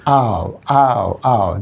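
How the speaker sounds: "Ow" glides from open a into oo, falling; diphthong ow demo. Shown as a demonstration. Teacher showing class the diphthong, gliding ow. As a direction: slide "Ow" from ah to oo, three times. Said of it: "We pronounce these words like this".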